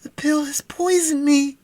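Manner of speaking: high, strained, poisoned cry; gasped in pain. A narrator's reading. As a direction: choke out the words in panic. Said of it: "he gasped".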